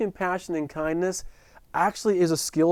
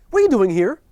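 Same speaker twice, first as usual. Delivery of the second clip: sharp loud demand, pitched up; bursting in and demanding answers; the nurse confronts the wife and me, demanding loudly; 5 semitones higher, 8 dB louder, faster than usual. Retold or imitated. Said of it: "just with this demand".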